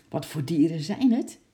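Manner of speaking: mildly curious, with a questioning lift. A narrator's reading. Lotje asking uncle about new animals, curious. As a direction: ask with a touch of eager interest. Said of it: "vroeg Lotje nieuwsgierig".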